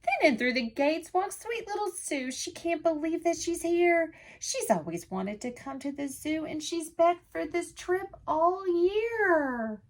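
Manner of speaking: lively picture-book read-aloud in rhyme; sing-song storytime voice for little listeners; reading to children. A narrator's reading. Teacher reading a rhyming book to children, lively.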